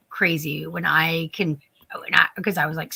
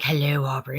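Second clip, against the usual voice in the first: lightly voiced creepy low hello; a hint of a stalker's low greeting; mock creepy greeting; 7 semitones lower, 4 dB quieter than usual. Friend playing Robert Englund, a touch of low creepy voice. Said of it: "And he's like".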